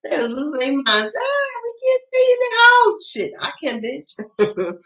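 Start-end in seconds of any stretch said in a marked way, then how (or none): 1.2-3.1 very high, slow whine; mocking whiny people. Mocking people stuck home in the pandemic, high whine. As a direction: mimic them complaining about staying home.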